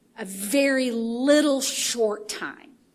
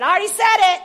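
louder, defiant "I already said it"; stubborn defiance; mad at the devil; 8 semitones higher, 8 dB louder, faster than usual. Retold or imitated. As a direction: throw it back at the devil. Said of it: "Until I'm mad".

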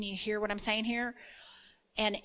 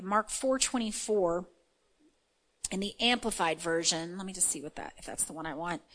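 normal talking voice

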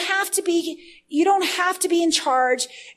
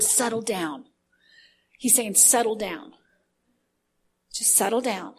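soothing; 4 semitones lower, 5 dB quieter than usual. Retold or imitated. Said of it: "I hear the Lord".

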